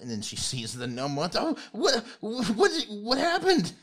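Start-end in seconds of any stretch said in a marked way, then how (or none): 1.1-3.7 very high, loud panicked granny voice; alarmed old lady. Acted. Old lady spotting the hurt gnome, high and loud. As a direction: squeal it out as a frantic old woman.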